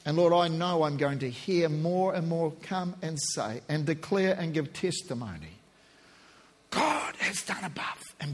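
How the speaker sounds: low, soft, hushed prayer; a pastor's soft closing prayer; quiet prayer. Real, not acted. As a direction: pray gently over the listeners. Leads sinner's prayer.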